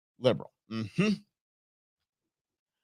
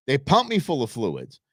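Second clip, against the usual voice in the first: ordinary talk, nothing marked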